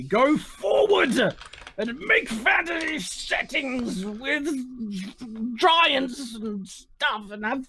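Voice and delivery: high, slow, booming-herald send-off; drawn-out, theatrical high proclamation; grand mock blessing. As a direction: send them forth like a hammy king. Grandly bestows his blessing on viewer.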